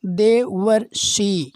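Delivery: देवर्षि stretched very slowly, syllable by syllable, the अर clearly sounded; teacher slowly pronounces देवर्षि, leaning on the अर; drawn-out demo. Shown as a demonstration. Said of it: "अर की ध्वनि".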